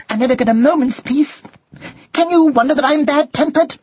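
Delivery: high, fast fed-up rant; angrily. A narrator's reading. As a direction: rattle off complaints as a bad-tempered mother mouse. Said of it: "said Drusilla angrily".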